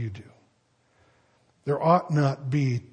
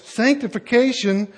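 normal talking voice

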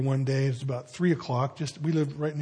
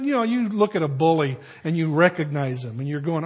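normal talking voice

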